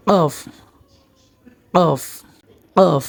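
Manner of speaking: কফ begun with a k far back in the throat; কফ as "qoff"; deep Arabic Qaf. Shown as a demonstration. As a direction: demonstrate কফ, ending on a drawn-out f. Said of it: "গলার মাঝখানে".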